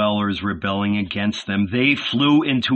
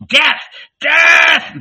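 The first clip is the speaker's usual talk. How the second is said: high, loud rabid howl; frenzied high yell; 8 dB louder than usual. A narrator's reading. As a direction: howl it like a bloodthirsty mob. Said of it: "they howled".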